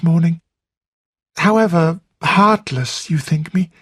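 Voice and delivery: can barely contain her delight; excited Flora. A narrator's reading.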